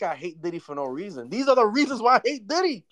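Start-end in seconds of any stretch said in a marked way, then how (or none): 1.3-2.8 high insistent declaration; emphatic. Real, not acted. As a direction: press the point, rising pitch. Insisting he has reasons.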